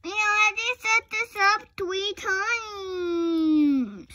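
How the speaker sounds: high, loud, slow whiny doll voice; whiny complaint. Pretend. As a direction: whine it like a fed-up kid.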